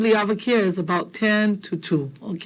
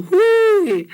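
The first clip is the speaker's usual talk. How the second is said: high, loud hooey called from outside; calling from the driveway; 12 semitones higher, 6 dB louder than usual. Retold or imitated. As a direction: hail the house from the driveway. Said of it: "They call from outside in the driveway and they go".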